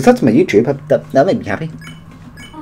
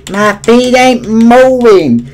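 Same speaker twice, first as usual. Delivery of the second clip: loud drawn-out confusion; 9 semitones higher, 4 dB louder, slower than usual. Real, not acted.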